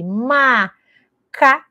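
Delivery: put-on Macaco dragged out very slowly, syllable by syllable like a struggling child; imitating a child stuck sounding out a long word; slow syllabified reading. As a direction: sound out Macaco one slow piece at a time. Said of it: "ela tá lendo de forma silabada".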